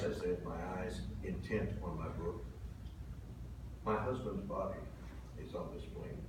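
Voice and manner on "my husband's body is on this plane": hushed revelation. Retold or imitated. Widow's quiet revelation.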